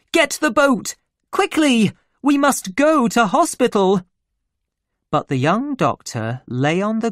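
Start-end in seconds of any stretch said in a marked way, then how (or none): 0.1-4.0 high, loud emergency orders; frightened, urgent cry. Acted. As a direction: call out urgently for help. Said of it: "she cried".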